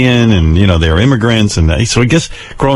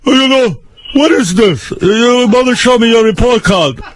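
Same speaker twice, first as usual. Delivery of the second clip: lightly voiced stern father, higher pitched; mock the father scolding in a higher voice; father's voice; 12 semitones higher than usual. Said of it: "he goes".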